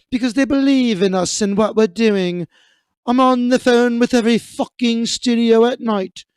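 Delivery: imitating a ranting Tom Cruise in a put-on British accent; mock British Tom Cruise. Host reading Cruise's set rant to cohosts, mock British accent. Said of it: "I don't know why I made Tom Cruise British".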